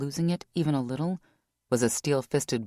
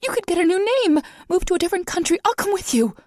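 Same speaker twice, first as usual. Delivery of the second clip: much higher and louder, breathless begging; desperate, out of breath; panting, high-pitched plea; 7 dB louder, faster than usual. Acted. Vanessa begging.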